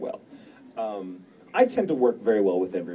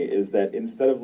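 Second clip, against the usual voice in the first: ordinary talk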